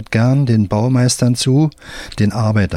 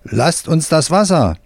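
worker shouting; 6 semitones higher than usual. Acted. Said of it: "ein Arbeiter schreit".

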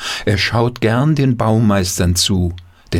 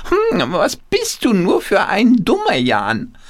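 high, squawky hand-puppet voice; quacking puppet voice; 10 semitones higher than usual. Acted. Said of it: "mit verstellter Stimme quakt er los".

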